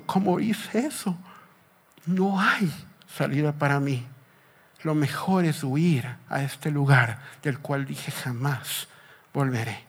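soft, low, defeated murmur without sobbing; subdued voice of a man giving up; acted dejected lament. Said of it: "está desde esa barca llorando".

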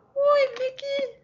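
very high cartoon mouse squeak; Mickey Mouse voice. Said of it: "that's Mickey Mouse".

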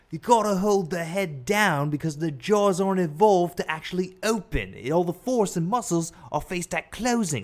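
Steve Irwin in a high, put-on Australian accent. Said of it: "that's not a great Australian accent".

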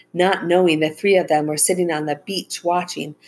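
ordinary delivery

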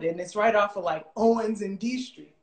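usual speaking voice

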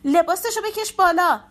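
high, loud urgent shout; frantic shouted command. Acted. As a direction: yell the order in panic. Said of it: "شمسی داد زد".

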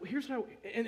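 ordinary delivery

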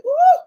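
high loud ooh, come on; joyful preacher call; excited ooh. Real, not acted. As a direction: hype the crowd up. Preacher exclamation.

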